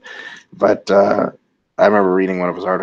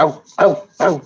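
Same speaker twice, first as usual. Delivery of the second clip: higher, louder playful yelps of fake pain; taunting mock pain; 7 semitones higher, 4 dB louder than usual. Retelling Al Pacino shot by Keanu Reeves, mock ows. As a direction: imitate a tough guy mocking being hit. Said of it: "he's doing this rope-a-dope Italian guy thing".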